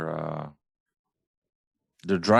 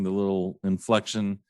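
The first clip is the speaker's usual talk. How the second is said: usual speaking voice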